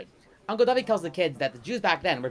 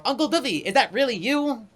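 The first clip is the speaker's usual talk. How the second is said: a kid asking in a lightly voiced British accent; child's British accent; 7 semitones higher, 4 dB louder than usual. British child greeting Uncle Dovey in a lightly put-on British accent. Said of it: "one of them is like".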